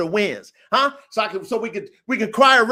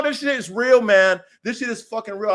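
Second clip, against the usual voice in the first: ordinary talk, nothing marked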